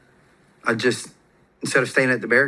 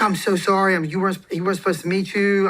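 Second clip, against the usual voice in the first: apologetic wife; 7 semitones higher than usual. Retold or imitated. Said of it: "She's like".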